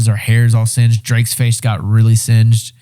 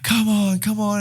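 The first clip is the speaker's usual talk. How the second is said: urging on; 10 semitones higher, 7 dB quieter, slower than usual. Retold or imitated. Willing fuses to catch.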